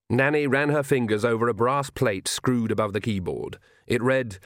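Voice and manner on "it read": ordinary delivery, nothing marked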